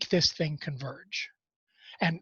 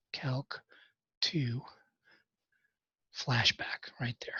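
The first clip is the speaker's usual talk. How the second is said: whispered aside; 5 semitones lower, 8 dB quieter, slower than usual. Real, not acted. Muttered aside after a slip.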